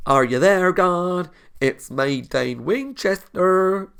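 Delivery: high, loud put-on accent, part Southern, part Swedish; muddled Southern-Swedish accent. Reactor hamming up the episode title, muddled Southern-Swedish accent. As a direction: botch a Southern-cum-Swedish accent, drawing out the name at the end. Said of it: "what that accent was".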